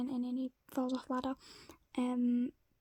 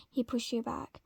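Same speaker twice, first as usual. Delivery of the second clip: ordinary delivery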